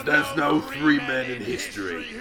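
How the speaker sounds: first, halting try at a slow heel promo voice, lightly done; slow and deliberate, a hint of Barrett; Barrett impression. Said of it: "I can't do the".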